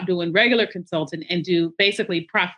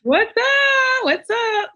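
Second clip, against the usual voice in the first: excited greeting; much slower than usual. Real, not acted. Guest answers the excited greeting.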